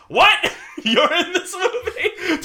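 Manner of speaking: high, loud shriek breaking into laughter; hammed-up disbelief, cracking up; mock outrage, squealed through laughter; Colin Farrell's shocked outburst. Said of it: "Colin Farrell just goes".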